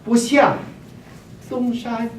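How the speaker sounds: "不像 东山" read with marked tones; tonal reading. Shown as a demonstration. 老师用声调朗读诗句. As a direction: chant "不像 东山" tone by tone. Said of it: "high high low low".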